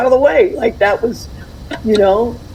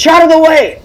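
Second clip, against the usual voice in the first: loud, high barked order to move; mock Italian American dad clearing the way; imitating her dad; 9 semitones higher, 5 dB louder than usual. Aunt mimics her dad shouting at the kids, loud and high. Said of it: "[name]'s dad used to say".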